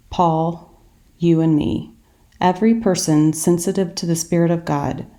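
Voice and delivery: usual speaking voice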